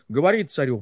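ordinary delivery, nothing marked

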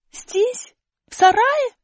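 высоко, с подъёмом; удивлённо переспроси; удивление. Acted. Аля удивлена. (высокое удивлённое переспрашивание)

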